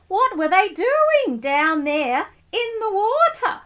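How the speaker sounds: anxious worry. Acted. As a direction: fret over what's happening underwater.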